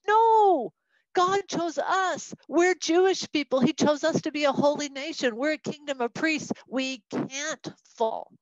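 high, loud cry of disbelief; protesting king. Acted. Preacher voices the king refusing Jerusalem's fall, protesting in disbelief. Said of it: "from the king's point of view going".

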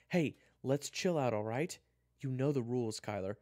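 loud, high attempt to calm him; urgent intervention. June steps in between the boys, loud and urgent. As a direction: call out to break it up.

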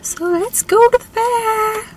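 bright eager call to go; excited. Real, not acted. Excited announcement heading in.

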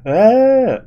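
high, loud put-on scream; mock Superman yell. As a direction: imitate a wailing Superman. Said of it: "you just hear".

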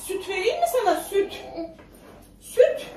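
high-pitched tempting offer. Real, not acted. Mother offering her baby milk, high coaxing. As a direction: dangle it in a high little voice.

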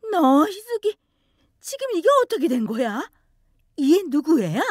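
high, loud shocked mother demanding answers; a mother's stunned outburst at her daughter; shocked scolding. Acted. Mother confronting her daughter, shocked and scolding.

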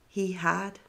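plain reading voice

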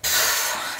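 short breathy lip puff; annoyed pff. Shown as a demonstration. Showing the annoyed French pff. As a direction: blow out a fed-up pff. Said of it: "when you're annoyed".

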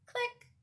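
high, quick clicking sound; little high-pitched camera click. A narrator's reading. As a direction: chirp a tiny shutter click.

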